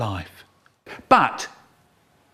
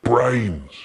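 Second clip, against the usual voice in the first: fed-up zombie; 3 semitones lower than usual. Acted. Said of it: "all fed up, and he says".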